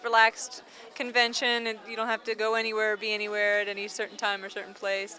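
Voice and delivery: ordinary delivery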